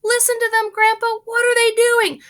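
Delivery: put-on very high, loud eager boy; excited boy. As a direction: eagerly ask grandpa. Charlie excited.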